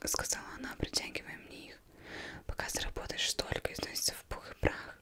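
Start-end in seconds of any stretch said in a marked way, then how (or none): none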